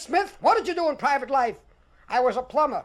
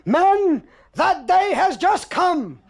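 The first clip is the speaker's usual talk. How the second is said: higher, louder and drawn out slowly; triumphant punchline; 5 semitones higher, 4 dB louder, much slower than usual. Retold or imitated. Sergeant springs his wooden-soldier payoff on the men, louder, slow and higher. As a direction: land it loud and slow.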